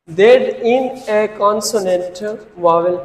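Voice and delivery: dictating slowly. Shown as a demonstration. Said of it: "teacher dictating rule".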